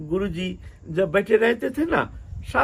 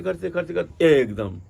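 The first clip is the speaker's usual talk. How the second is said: ordinary delivery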